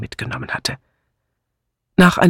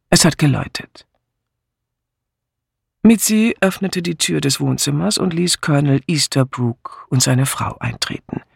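ordinary delivery, nothing marked

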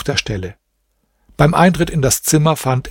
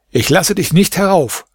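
threat called down the well, higher pitch; a prince's raised, threatening call; 6 semitones higher than usual. A narrator's reading. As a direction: shout it down the well at him. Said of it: "rief er ihm zu".

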